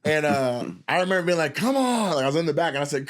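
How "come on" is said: impatient urging. Retold or imitated. Impatient from backstage.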